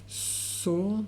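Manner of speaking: "saw" with a drawn-out aw vowel; long O sound. Shown as a demonstration. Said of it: "with a long O sound".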